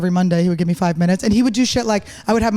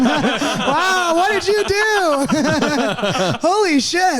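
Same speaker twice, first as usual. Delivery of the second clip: high-pitched, drawn-out laughing shock; laughing disbelief; 7 semitones higher, much slower than usual. Real, not acted. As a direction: crack up while demanding an explanation. Mock shock at co-host's name.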